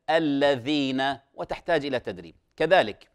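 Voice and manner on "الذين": slow "الذين" with lingering voiced th; correct dhal drill. Shown as a demonstration. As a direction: draw out "الذين", clear ذ between the teeth. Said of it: "وهكذا".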